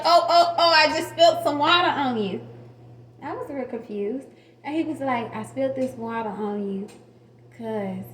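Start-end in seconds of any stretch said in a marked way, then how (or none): 0.0-2.4 loud, higher mock oops from the husband; mock surprised husband faking a spill; fake innocent. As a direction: ham up a fake accident.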